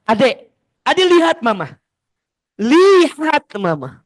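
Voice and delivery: loud, insisting, slowing on the last words; insistent mother. Retold or imitated. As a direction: demand the child look at her. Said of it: "Bunda sering nggak gini sama anak".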